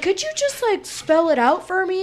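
high-pitched mock request; mimicked clueless asker. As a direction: put on a high voice, imitating someone asking. Mimicking people asking for a spelling.

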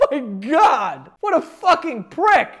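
loud, high, drawn-out disbelief; slow, high-pitched indignant outburst; outraged. Real, not acted. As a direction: exclaim in appalled outrage. Outraged reaction to cruel remark.

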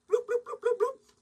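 high comic bloop bloop of chugging water; gulping sounds. Retold or imitated. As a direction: mimic glugging a bottle down, high and silly. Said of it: "I'd open that shit and I'd go".

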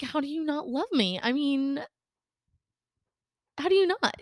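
high-pitched, retold hurt protest; hurt disbelief. Friend retelling her reaction to not being loved, high hurt disbelief. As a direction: act out being baffled and a little wounded. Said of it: "my feelings were hurt".

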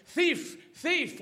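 high, urgent barked words; dog raising alarm. Acted. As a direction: yap it out like a guard dog. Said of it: "trying to say".

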